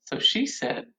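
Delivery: normal talking voice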